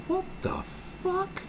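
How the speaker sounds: slow, drawn-out whispered gasp; hushed, hammed-up astonishment; stunned disbelief. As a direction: mouth it breathily, aghast. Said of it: "you just go".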